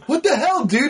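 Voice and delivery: high-pitched mock outrage; indignant squeal, hammed-up; outraged. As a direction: play the hero's shocked protest, voice way up. Acting out hero's enraged reaction.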